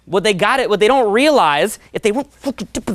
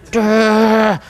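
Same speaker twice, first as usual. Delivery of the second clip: dopey grunt. Retold or imitated.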